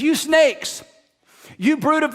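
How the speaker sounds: high, loud preaching rebuke; Jesus rant. Retold or imitated. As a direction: hurl it at them like a prophet denouncing hypocrites. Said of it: "it is a rant".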